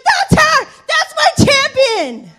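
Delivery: high, loud triumphant cry; proud cheering. Retold or imitated. Coach celebrating at the finish line, loud and proud. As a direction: shout it with a father's pride. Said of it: "he was like".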